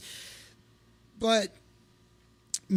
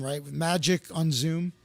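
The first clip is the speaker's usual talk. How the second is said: ordinary talk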